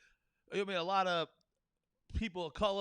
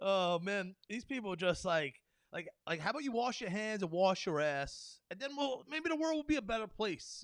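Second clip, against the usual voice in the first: usual speaking voice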